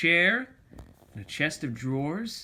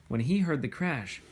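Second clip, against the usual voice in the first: ordinary delivery, nothing marked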